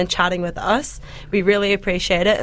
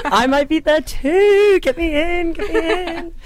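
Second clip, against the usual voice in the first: laughing plea; 9 semitones higher, 3 dB louder than usual. Real, not acted. Begging to be cast, laughing.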